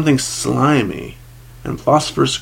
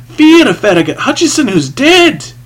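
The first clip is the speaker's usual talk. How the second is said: high, loud, agitated cry; fearful outburst; 12 semitones higher, 8 dB louder than usual. A narrator's reading. Haunted man confessing to the priest, fearful outburst. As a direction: burst out with it, voice leaping up.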